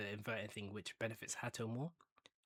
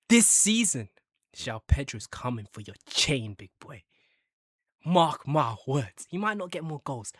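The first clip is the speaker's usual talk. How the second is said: much louder hype declaration; boastful shout; 3 semitones higher, 13 dB louder than usual. Pretend. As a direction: announce it like a bold prophecy. Boasting about new striker.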